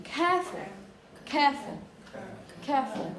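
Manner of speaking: "careful" with all the energy on "care"; "careful" with a weak schwa ending; front-loaded stress. Shown as a demonstration. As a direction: punch the start of "careful", let "ful" die away. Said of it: "used all your energy in the beginning".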